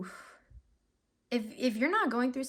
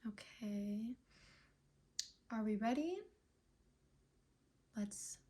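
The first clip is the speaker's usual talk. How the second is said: soft breathy whispered okay; 9 dB quieter than usual. Real, not acted. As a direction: whisper it to yourself while focusing. Reader settling into a reading.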